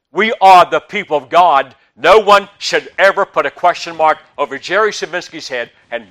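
loud, driving preacher's call; full-force preaching. Acted. Preacher exhorting the congregation, loud and driving. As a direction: preach it hard to the pews.

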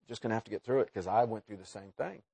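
hushed, low-pitched thinking aloud; quiet inner thought. Acted. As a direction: voice it as a private thought, low and soft. Faculty's unspoken thought, voiced low.

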